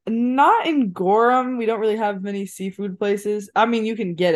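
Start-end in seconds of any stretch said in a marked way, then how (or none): 0.1-1.6 NOT drawn out and punched; slow, emphatic "Not in Gorham"; stress on NOT. Real, not acted. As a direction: lean hard on "Not", stretch the words. Correcting host about Gorham lobster.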